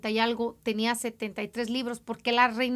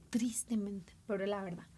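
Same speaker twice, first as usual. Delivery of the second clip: whispered regret; 5 semitones lower, 12 dB quieter than usual. Real, not acted. Sad aside to audience.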